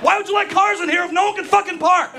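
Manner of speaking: fed-up driver hollering out the window; angry shout back. Retold or imitated. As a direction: yell back in disbelief.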